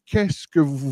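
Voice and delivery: ordinary talk, nothing marked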